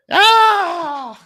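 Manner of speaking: high, loud imitated movie scream; Wilhelm scream. Co-host mimics stock film scream, Wilhelm scream. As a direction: scream like a stuntman falling, high and full. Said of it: "Or the Wilhelm scream".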